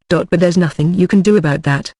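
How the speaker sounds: ordinary delivery, nothing marked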